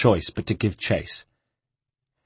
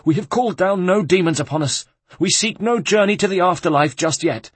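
high, agitated plea; frightened protest; 9 semitones higher, 4 dB louder than usual. A narrator's reading. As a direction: protest to the demon at high pitch. Terrified abbot protests to supposed demon.

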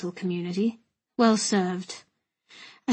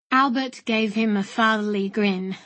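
usual speaking voice

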